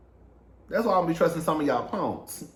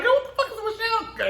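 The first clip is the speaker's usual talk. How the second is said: shocked; faster than usual. Retold or imitated. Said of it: "I was like".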